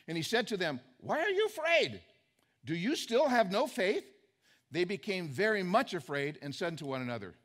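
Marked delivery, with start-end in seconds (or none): 1.0-4.0 challenging rebuke. Retold or imitated. Jesus confronts the disciples.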